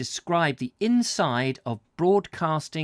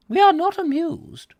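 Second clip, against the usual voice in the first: much higher, louder haughty royal voice; regal queen; 6 dB louder than usual. Retold or imitated. Reader quoting the queen's portrait, haughty regal voice. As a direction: deliver it with icy regal disdain.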